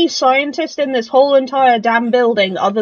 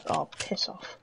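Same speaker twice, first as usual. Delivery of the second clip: unvoiced whisper, much softer; whispered oath; 8 semitones lower, 17 dB quieter than usual. Real, not acted. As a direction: hiss it under your breath. Muttered insult.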